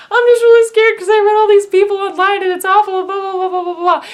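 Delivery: high, loud hammed-up fretting; mock panicked rant. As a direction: gush your fears in a hammed-up rush. Mock-anxious rant to radiologist.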